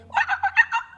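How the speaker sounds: high squeaky giggle; playful high squeak; squeaky rock noise. A narrator's reading. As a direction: squeak like a tiny rock creature. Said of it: "the rock answered in squeaks".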